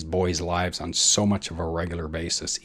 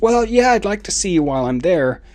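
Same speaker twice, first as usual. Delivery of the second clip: much higher sweet mom voice, quicker on the second line; voicing mom; 10 semitones higher, 5 dB louder than usual. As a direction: put on mom's sing-song cheer.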